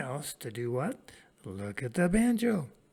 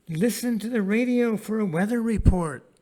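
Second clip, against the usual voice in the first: reading aloud to class; 7 semitones higher, 9 dB louder than usual. Shown as a demonstration. Teacher reads model sentence for class.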